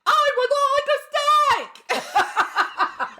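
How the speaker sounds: high, drawn-out mock-American yell, laughing; hammed-up American accent, stretched and squealed high; yelled bad American accent. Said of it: "yells when she's doing it".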